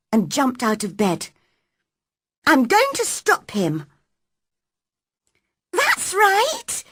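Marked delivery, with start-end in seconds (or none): 2.5-3.9 put-on higher, firm girl's voice, stress on going; determined girl. Mary setting off to stop Colin. 5.7-6.8 put-on high young maid agreeing eagerly; approving maid. Martha encouraging Mary.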